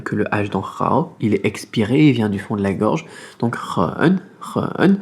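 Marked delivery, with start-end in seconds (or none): 4.4-5.0 "run" with a doubled vowel, dipping then rising; third tone run. Shown as a demonstration. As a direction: drag "run" down and back up. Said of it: "Troisième ton". (run au troisième ton)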